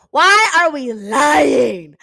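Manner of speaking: high, loud, slow exasperated shout; furious slow shout; angry outburst. Real, not acted. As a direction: yell at the characters in frustration. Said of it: "I was so angry".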